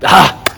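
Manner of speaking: loud high ah at a mistake; frustrated cry. Real, not acted. [name] yelping at his fumbled introduction. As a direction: yelp at the slip. Flubbed take.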